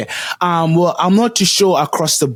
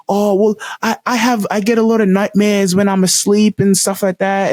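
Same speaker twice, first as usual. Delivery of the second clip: put-on higher-pitched child explaining a worry; imitated little kid; 9 semitones higher than usual. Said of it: "these little kids were like".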